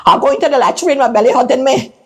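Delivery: high-pitched, whining excuse; hasty excuse. Acted. Gertrude retreats at her door, high-voiced hasty excuse. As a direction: blurt a high excuse to get away. Frightened cousin makes excuse to flee.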